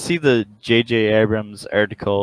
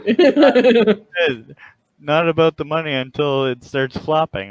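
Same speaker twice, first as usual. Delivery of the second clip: normal talking voice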